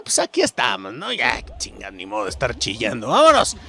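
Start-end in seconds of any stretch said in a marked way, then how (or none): 3.1-3.6 high, loud, slow rallying cry; real excited battle cry; hype shout. Real, not acted. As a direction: pump yourself up, shout it out. Cheering himself on.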